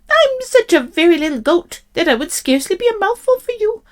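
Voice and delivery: high little goat voice; pleading little goat. A narrator's reading. Little goat talking the troll out of eating him, high plea. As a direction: plead your case in a small high voice. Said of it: "he cried".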